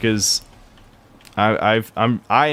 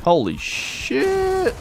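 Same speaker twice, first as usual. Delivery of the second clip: shocked high yelp; much slower than usual. Real, not acted. Sudden shock during gameplay.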